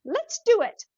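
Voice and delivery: put-on high, loud eager kid voice; excited child. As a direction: jump at the idea like a kid. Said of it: "She goes".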